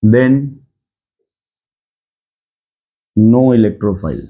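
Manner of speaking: low, slow words with long pauses; note dictation. Shown as a demonstration. Teacher dictating a rule to students, slow dictation. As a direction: dictate piece by piece, leaving time to write.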